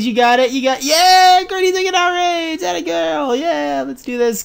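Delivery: thrilled squeal of relief; elated cheer. Real, not acted. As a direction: cheer the Pokemon on. Courtney survives the hit.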